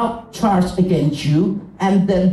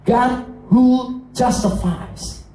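normal talking voice

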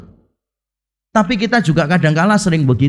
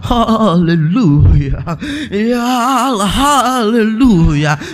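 drawn-out, trembling mock hallelujah; quavering showy prayer; 3 semitones higher, much slower than usual. Former pastor praying over the sick, imitated with quavering intonation. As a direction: stretch each syllable in an imitated fervent quaver. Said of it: "intonasinya tinggi, terus nadanya bergetar-getar".

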